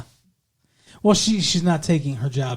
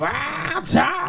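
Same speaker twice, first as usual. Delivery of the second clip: put-on old prospector; 10 semitones higher than usual. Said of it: "that voice that you're doing".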